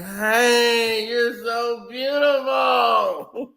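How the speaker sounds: slow, drawn-out mock gushing; long drawled mock come-on; gushing drunk girlfriend. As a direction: imitate her fawning over everyone, stretching each word. Voices the overfriendly drunk girlfriend.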